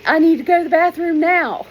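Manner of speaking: loud, high panicked yell; loud high shout to the teacher. Retold or imitated. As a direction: blurt it out across the class. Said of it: "stood up out of my seat and yelled".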